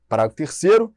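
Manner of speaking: usual speaking voice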